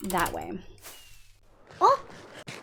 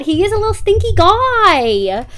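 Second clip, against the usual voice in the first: cooing pet talk; 9 semitones higher, 9 dB louder than usual. Real, not acted. Streamer gushing over her smelly cat, in loud high baby talk.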